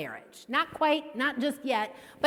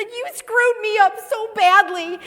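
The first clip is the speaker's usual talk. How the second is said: mock accusation; 10 semitones higher, 9 dB louder than usual. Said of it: "And I said".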